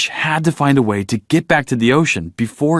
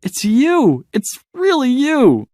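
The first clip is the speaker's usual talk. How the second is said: much higher, tearful joyful cry; overjoyed cry; slower than usual. Acted. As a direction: burst out with joy at finding your parents. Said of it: "Dory cried".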